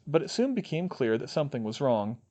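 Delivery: ordinary delivery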